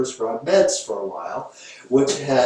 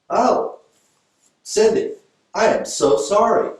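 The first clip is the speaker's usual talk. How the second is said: high, loud sudden "oh Cindy" of pity; mock sympathy; 11 semitones higher, 6 dB louder than usual. Therapist to client, high gushing sympathy. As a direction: gush with exaggerated sympathy.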